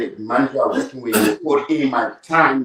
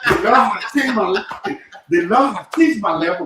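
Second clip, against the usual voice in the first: exuberant praise; 6 semitones higher than usual. Real, not acted. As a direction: testify joyfully with the pitch held high. Exuberant praise for changed fortunes.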